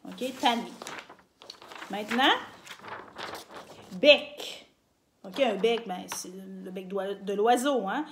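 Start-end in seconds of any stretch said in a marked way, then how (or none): 4.0-4.7 "bec" said louder as a headword; emphatic new word. Shown as a demonstration. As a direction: announce "bec" with a lift. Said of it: "Mais au Québec, un bec".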